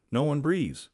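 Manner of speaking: ordinary delivery, nothing marked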